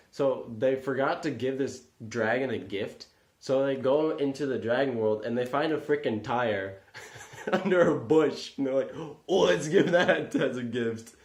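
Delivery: can barely get it out for laughing. Real, not acted. Laughing retelling of silly story.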